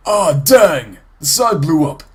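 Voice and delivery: loud startled exclamation; surprised outburst. Real, not acted. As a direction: blurt it out as the wall blows. Explosion surprises him.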